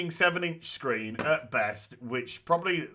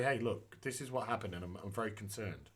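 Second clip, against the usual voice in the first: soft reasonable voice; 5 semitones lower, 13 dB quieter than usual. Retold or imitated. Voicing the sensible email reply.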